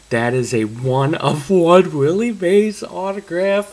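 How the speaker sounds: loud, slow, rising disbelief at a one of one; shouting in shock, words stretched out; stunned excitement. Real, not acted. Pulls rare Willie Mays card.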